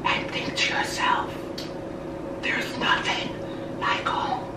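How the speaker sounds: soft, voiceless movie-quote whisper; imitated whisper. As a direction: whisper it softly into her ear. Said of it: "the lady whispered in her ear".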